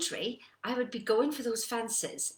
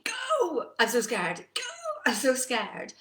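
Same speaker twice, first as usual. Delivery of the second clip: loud, high yelps of "Go" between scared asides; hammed-up panic, urging the horse on; petrified rider, re-enacted; 4 semitones higher, 6 dB louder, slower than usual. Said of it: "Inside, I was petrified".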